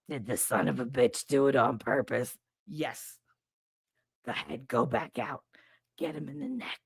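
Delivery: low, soft, sly nurse voice; conspiratorial nurse. Retold or imitated. Nurse Pat quietly egging the kid to hit back. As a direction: lean in and egg the kid on quietly.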